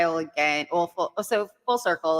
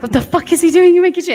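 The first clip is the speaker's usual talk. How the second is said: much higher, louder shocked outburst; retold alarm; 13 semitones higher, 13 dB louder than usual. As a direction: blurt it out loud and high, mock-alarmed. Retold alarmed reaction on finding him.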